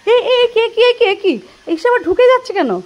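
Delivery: mock surprise. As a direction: feign shock at the naughty boy. Mock surprise at boy entering.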